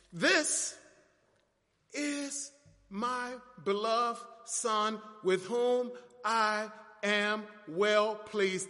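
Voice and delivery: very slow, word-by-word declaration; God the Father affirming. Acted. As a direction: spell out the Father's affirmation with long pauses. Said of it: "He affirmed by simply saying".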